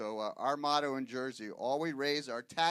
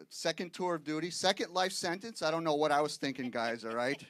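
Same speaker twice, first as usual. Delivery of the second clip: ordinary talk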